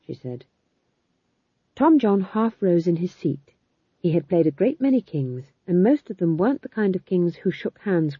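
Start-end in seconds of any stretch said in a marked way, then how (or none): none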